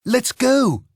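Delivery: high, eager call; excited cry. A narrator's reading. Mickey calls his friends to flee, excited. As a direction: urge the friends to run. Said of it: "cried Mickey".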